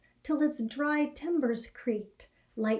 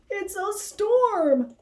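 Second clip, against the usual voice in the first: Harold's voice, higher and louder, slow on storm; a big rabbit alarmed at the sky; worried rabbit; 10 semitones higher, 6 dB louder, much slower than usual. A narrator's reading. Harold seeing the storm come, alarmed and drawn out. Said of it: "said poor Harold".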